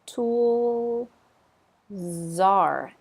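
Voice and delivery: tool then czar, the z moved onto are; z linked to are. Shown as a demonstration. Teacher splitting tool czar to show linking, careful. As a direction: slide the z of tool over to czar. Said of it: "that little soft Z jumps over onto the verb are".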